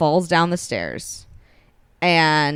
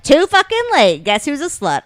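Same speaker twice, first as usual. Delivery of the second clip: higher, louder defiant taunt; 10 semitones higher, 5 dB louder than usual. Retold or imitated. She taunts her father, higher and defiant. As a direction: throw it in his face. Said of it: "And she's like".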